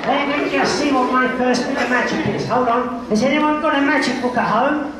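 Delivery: put-on bright, animated children's entertainer; kids party magician. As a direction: play up the magic for little kids. Magician hosting children's show.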